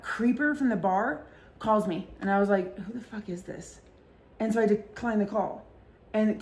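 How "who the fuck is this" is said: much softer, under the breath; hushed who-is-this grumble; muttered annoyance. Retold or imitated. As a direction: mutter it to yourself, irritated. Unknown caller at night.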